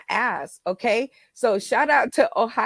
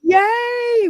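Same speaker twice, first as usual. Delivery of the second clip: high, loud cheer of joy; a loud, high celebratory yay; excited cheer; 13 semitones higher, 7 dB louder than usual. Real, not acted. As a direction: whoop it up with delight. Cheers after crowd response.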